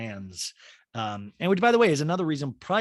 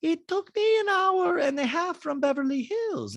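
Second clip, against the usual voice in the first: very high, slow, airy socialite; bad Diana impression. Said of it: "this is the worst Diana Jane".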